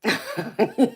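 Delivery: high, loud burst of laughter. Real, not acted. Reacting to Mandy G's comment, laughing out loud. Amused reply to viewer comment.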